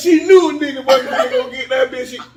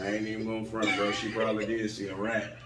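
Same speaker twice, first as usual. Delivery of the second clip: low, soft, totally unbothered; nonchalant; 12 semitones lower, 13 dB quieter than usual. Retold or imitated. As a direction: shrug it off like it's nothing. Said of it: "with the most nonchalant voice".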